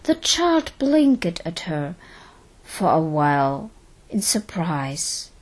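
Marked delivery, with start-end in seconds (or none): none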